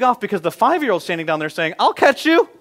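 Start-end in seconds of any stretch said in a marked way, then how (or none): 1.8-2.5 put-on high, loud little-kid voice; child's promise. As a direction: play the eager five-year-old. Five-year-old voiced in story.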